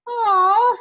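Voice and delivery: a wounded, crying oh; loud mock whimper of hurt. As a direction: fake a little sob on the word. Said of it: "And I was like".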